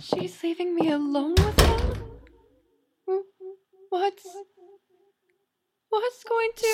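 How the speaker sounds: loud, slow rising alarm; panicked thought. Acted. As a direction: dread at being left alone. Bunny panics left with demon.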